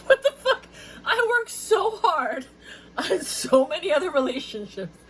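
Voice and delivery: high indignant laugh; laughing mock outrage. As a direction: shriek it while laughing.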